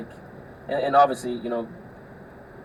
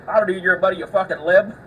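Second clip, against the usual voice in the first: hostile snap; 6 semitones higher, 7 dB louder than usual. Retold or imitated. Hostile redneck voiced in story.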